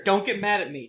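ordinary talk, nothing marked

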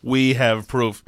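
slow, mocking repeat of their words. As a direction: drag it out to mock the poster.

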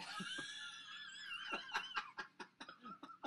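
high screaming laugh burst; shrieking laugh. Real, not acted. Huge laugh at strong pickle.

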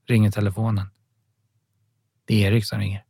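usual speaking voice

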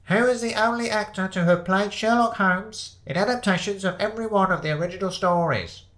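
high-pitched voice heard from inside the pub; quizmaster. Acted. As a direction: read the question out to the room. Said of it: "the voice of the quizmaster said".

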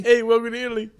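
put-on loud, high New York accent greeting. Italian host greeting a visitor in a New York accent. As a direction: boom out a New York tourist-guide welcome. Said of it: "I'm doing, like, New York".

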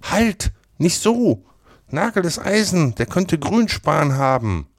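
put-on high-pitched boy's warning, urgently halting a friend; alarmed boy. A narrator's reading. Tom stops Huck pricking himself, alarmed. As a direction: stop him in a high, alarmed voice.

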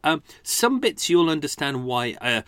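ordinary delivery

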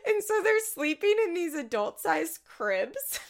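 high, tickled, close to cracking up; real amusement. Real, not acted. Podcaster describing adult cribs on the show, cracking up. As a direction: push it out high between laughs.

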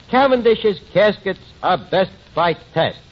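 mock slogan read in a booming announcer voice; radio announcer. Pitching his radio ad to the undertaker, announcer voice. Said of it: "I come on and I say".

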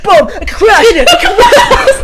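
loud, high, laughing sound effects; wave guy imitation. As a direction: mimic crashing waves through laughter. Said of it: "You know what you sound like?".

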